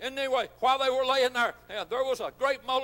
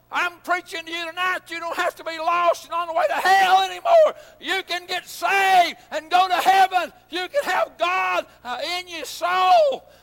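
higher, louder, slowing preaching shout; preaching at full force; 5 semitones higher, 6 dB louder than usual. Acted. Preacher calling the lost to get saved, shouting. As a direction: drive it home at full force.

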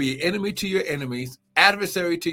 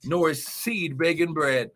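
fiery preaching; 4 semitones higher than usual. Acted. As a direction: shout the praise, build the fire. Preaching at full force.

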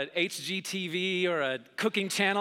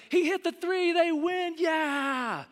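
announcer cheering; 11 semitones higher, slower than usual. Pretend. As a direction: call the buzzer beater. Pretend announcer winning shot.

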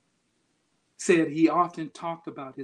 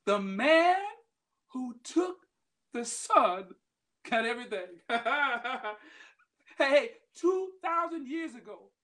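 higher, rising preacher's cadence; preaching; 8 semitones higher, 4 dB louder than usual. Acted. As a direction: drive the message home to the congregation. Preacher drives the point home.

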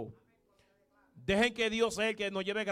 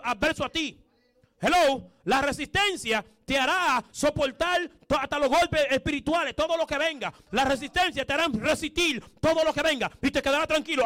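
higher and louder sermon that climbs line by line; building preaching; 5 semitones higher, 5 dB louder than usual. Acted. Preacher rallying the church to be resistant, in rising full-force preaching. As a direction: hammer each line home to the faithful.

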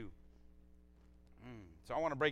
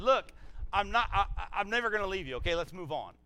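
brisk dismissal; 5 semitones higher, 6 dB louder than usual. Acted. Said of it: "he could easily just said".

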